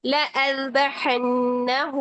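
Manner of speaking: लअज़बहन्नहु slow with doubled n; Arabic-style लअज़बहन्नहु, alif silent; Qur'anic recitation. Shown as a demonstration. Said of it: "पढ़ने की condition ये बन गई".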